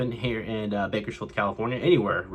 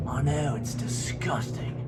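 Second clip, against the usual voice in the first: whisper; 5 dB quieter, slower than usual. Real, not acted. Aside to the dog.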